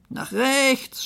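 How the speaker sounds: high, mild shout; shouted direction. Acted. Keeper directs the chase, shouting mildly. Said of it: "schrie einer".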